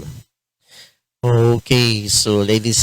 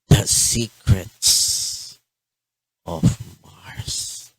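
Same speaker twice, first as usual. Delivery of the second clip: breathy hushed title reveal; dramatic whisper; much slower than usual. Acted.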